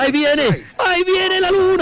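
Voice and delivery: high-pitched live commentator's cry; excited cry. Real, not acted. Commentator spotting the moon coming, excited cry. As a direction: call it out high, building with excitement.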